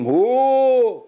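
long, high cold-shock holler; shouted "hoo!". Shown as a demonstration. Father showing cold water's first shock, shouted cry. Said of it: "קודם כל צועקים".

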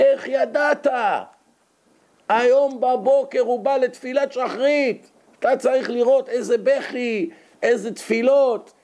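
high, excited retelling of astonishing news; amazed excitement. Retold or imitated. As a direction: burst out with amazed joy. Nissim calls, amazed at change. (ניסים מתקשר נרגש ומשתומם, בקול גבוה)

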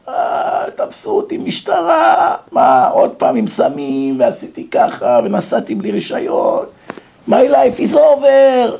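high, drawn-out whiny wail, breaking into air; slow mock bawling that builds to a cry; imitated crying and shouting. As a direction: mimic a despairing boy sobbing out his troubles. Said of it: "בוכה, צועק".

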